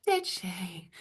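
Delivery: hushed retelling of "Did she?"; soft "Did she?". Retold or imitated. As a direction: drop "Did she?" to a quiet aside. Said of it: "what I didn't say was quite loud".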